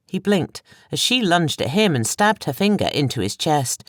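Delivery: ordinary delivery, nothing marked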